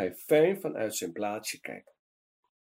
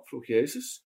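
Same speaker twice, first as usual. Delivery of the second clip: ordinary delivery, nothing marked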